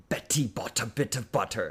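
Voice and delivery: each t in "Betty bought a bit of butter" sounded as a true t, not flapped; careful crisp t sounds. Shown as a demonstration. Demonstrates careful aspirated British-style pronunciation.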